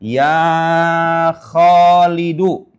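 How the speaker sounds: teacher modelling the Arabic call; drawn-out Arabic vocative. Shown as a demonstration. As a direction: stretch Ya Khalidu with a long final u. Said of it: "Ada namanya mufradul alam".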